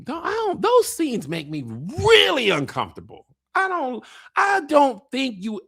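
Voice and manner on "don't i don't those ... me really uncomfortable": ordinary delivery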